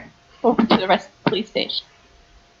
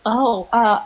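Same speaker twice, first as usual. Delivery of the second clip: ordinary talk, nothing marked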